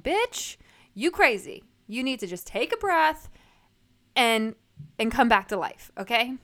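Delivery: stage a blunt intervention on yourself; sassy intervention. Acted. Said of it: "my highest self has to come, come and intervene".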